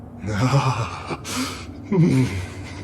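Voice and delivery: drawn-out, rising chuckle; amused, gloating laugh; slow mocking chuckle; villainous chuckle.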